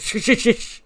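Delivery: loud, insistent string of shushes; acted hurried shushing; urgent shushing. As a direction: cut them off with repeated shushes. Character shushing someone in retold scene.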